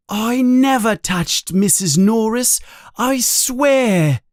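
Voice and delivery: high, urgent denial; loud protest. A narrator's reading. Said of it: "says Harry loudly".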